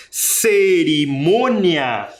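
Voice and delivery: Cerimônia stretched slowly, with a long nasal MÔ; syllables drawn out. Shown as a demonstration. Teacher demonstrating the stressed syllable, Cerimônia slowly drawn out. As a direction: draw out each syllable of Cerimônia, leaning on the nasal ô. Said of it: "Um acento é nasal".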